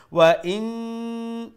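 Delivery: ওয়া ইন with the final n hummed long; held nasal ghunna. Shown as a demonstration. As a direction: hold ইন on a steady hum. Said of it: "আপনার নুন মুশাদ্দার গুণ".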